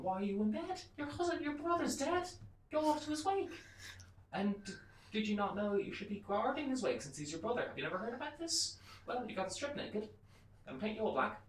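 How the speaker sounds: high-pitched put-on wife voice; scolding wife. Wife rousing husband in story.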